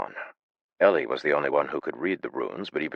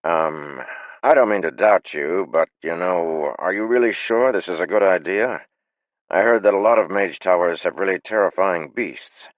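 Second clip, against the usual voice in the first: nervous; 4 semitones higher, 6 dB louder than usual. A narrator's reading. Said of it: "slowly raised his hand".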